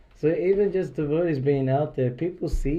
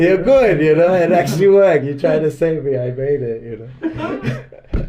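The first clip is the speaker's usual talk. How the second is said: laughing; 5 semitones higher, 11 dB louder than usual. Retold or imitated. Retold reply, laughing.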